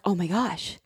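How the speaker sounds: breathy low oh my gosh under her breath; mock-horrified murmur; hushed dismay. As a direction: mutter it in shock, low and soft. Reaction retold, much quieter.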